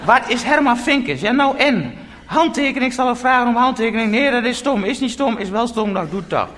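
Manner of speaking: high, excited bickering between fans; women spotting a celebrity. Retold or imitated. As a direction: imitate fans debating in high voices. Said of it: "die vrouwen ... keken door het ruitje".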